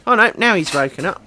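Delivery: normal talking voice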